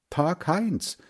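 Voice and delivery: lightly called hello to a friend; called greeting. A narrator's reading. Boy greets Heinz at the door, lightly called out. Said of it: "rief Hans Frieder".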